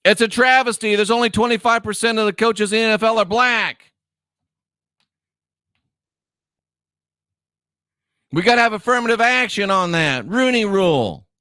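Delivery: high-pitched sarcastic protest; mock outrage. Host parodying complaints about NFL coaches, mock outrage.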